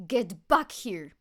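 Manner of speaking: slow, very loud villain shout; furious yell. A narrator's reading. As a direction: bellow after the fleeing cat. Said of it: "Reverence yelled".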